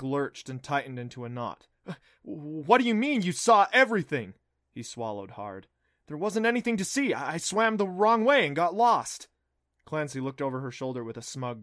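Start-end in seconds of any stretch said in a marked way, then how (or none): 2.2-4.3 raised, higher alarmed question. Acted. Alarmed boy demands. 6.1-9.3 nervous. Acted. Boy stammers a lie.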